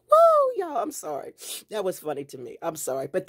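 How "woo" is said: loud, very high woo; big tickled whoop; whoop after laughing. Real, not acted. Recovering from laughing hard.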